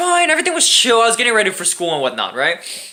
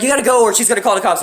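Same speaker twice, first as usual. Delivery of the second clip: fast urgent warning; faster than usual. Retold or imitated.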